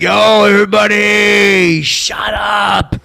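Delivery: slow, loud, high yell to silence everyone; mic shout. Retold or imitated. On the mic to the whole party, a slow drawn-out shout. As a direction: hold the words long and loud over the crowd.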